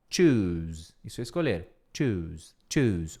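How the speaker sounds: "Choose" low, long "oo", buzzing z. Shown as a demonstration. Teacher showing "choose" with a z sound. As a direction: stretch "choose" and end on z. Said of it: "som de Z".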